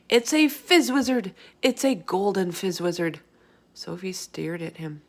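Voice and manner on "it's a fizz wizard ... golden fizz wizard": loud, high delighted announcement; thrilled exclaim. Acted. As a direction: burst out with the find. BFG thrilled by the dream.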